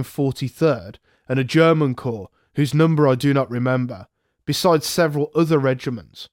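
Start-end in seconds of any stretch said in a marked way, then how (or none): none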